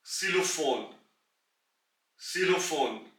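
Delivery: सिलोफोन, starting with ks; German word demo. Shown as a demonstration. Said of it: "एक ही example". (सिलोफोन का German उच्चारण)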